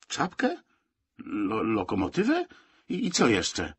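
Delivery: acted incredulous questioning; baffled stammer. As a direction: stutter in disbelief. Baffled reaction to odd demand.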